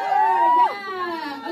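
high, far cry of delight; excited squeal. Real, not acted.

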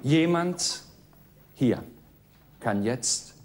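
a hint of a strict teacher; quiz show host. Game show host quiz read.

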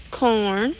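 normal talking voice

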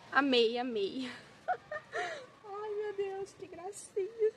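overwhelmed with joy, voice breaking into tears; happy tears. Real, not acted. Moved to tears opening gift plants.

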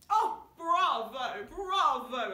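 loud, high posh congratulations; posh applause. Pretend. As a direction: gush like a very posh lady at the theatre. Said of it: "we're going to be very posh".

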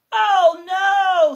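high, loud wail of despair; Mister Bear's loud, high, exasperated wail; exasperated cry. Acted. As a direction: cry out like a fed-up bear.

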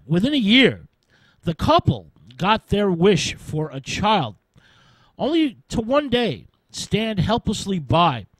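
ordinary delivery, nothing marked

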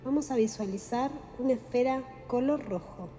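guided meditation. Acted. Guide leading listeners through breathing and chakra visualization, calm meditation voice. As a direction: guide the listener gently into relaxation, unhurried.

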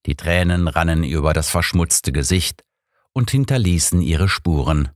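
usual speaking voice

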